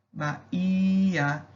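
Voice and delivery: "Bahia" with the i held long; stress on HI. Shown as a demonstration. Teacher showing the stressed syllable, "Bahia" with lengthened i. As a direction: stretch the middle syllable of "Bahia". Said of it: "a sílaba tônica da palavra é o H I".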